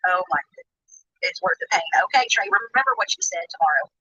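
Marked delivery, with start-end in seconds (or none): none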